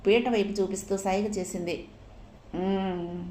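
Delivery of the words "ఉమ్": a quiet coaxing mmm; wanting groan. A narrator's reading. Husband moaning for something to his wife, soft groan. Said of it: "మూలిగాడు".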